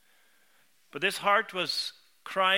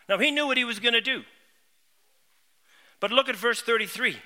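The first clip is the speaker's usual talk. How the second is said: fervent preaching; 5 semitones higher, 7 dB louder than usual. Acted. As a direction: preach it with swelling conviction. Preacher building on the passage.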